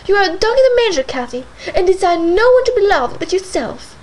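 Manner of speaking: loud, high outburst; heated accusation. A narrator's reading. Isabella accusing Cathy in a heated outburst. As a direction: throw the charge in her face.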